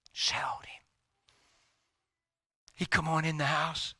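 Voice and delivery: usual speaking voice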